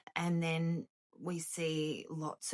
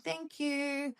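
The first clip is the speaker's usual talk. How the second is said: high, sing-out thank you; acted-out polite thanks, pitched right up; mock awkward thanks; 9 semitones higher than usual. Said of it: "I looked down at him and I thanked him".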